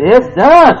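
high, loud and ringing; triumphant declaration. Acted. Preacher voicing Jesus declaring it done, loud high triumph. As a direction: proclaim it finished with triumph. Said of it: "Jesus said".

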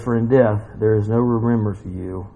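low, measured psalm reading; solemn scripture reading. Acted. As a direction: read the lament out gravely. Reads psalm aloud.

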